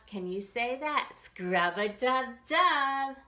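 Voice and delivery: usual speaking voice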